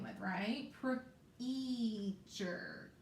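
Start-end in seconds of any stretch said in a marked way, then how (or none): 1.4-2.9 Preacher drawn out slowly, sound by sound; teacher segmenting the word for spelling; stretched out. Shown as a demonstration. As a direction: stretch Preacher out so every letter is heard. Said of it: "let's stretch it out".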